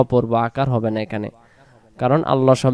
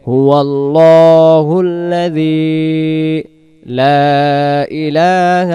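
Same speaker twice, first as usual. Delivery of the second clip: slow, drawn-out melodic recitation; Quran recitation; 3 semitones higher, 4 dB louder, much slower than usual. Acted. Teacher reciting the verse slowly for students to repeat. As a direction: chant each phrase slowly, stretching the vowels.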